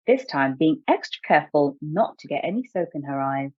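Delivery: usual speaking voice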